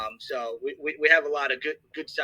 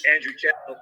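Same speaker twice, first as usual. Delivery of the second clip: choking; 2 semitones higher, 6 dB louder than usual. Real, not acted. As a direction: still choking, strained. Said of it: "Sorry, I'm choking right now".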